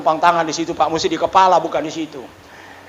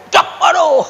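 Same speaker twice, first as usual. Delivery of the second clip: pained cry; 10 semitones higher, slower than usual. Retold or imitated. Recalling pain over speed bumps.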